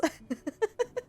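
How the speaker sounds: soft high laugh wiping eyes; teary high giggle. Real, not acted. Streamer laughing softly at stinging eyes. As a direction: giggle at her own tears.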